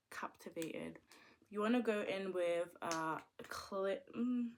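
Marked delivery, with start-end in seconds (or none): 0.1-1.0 whisper. Acted.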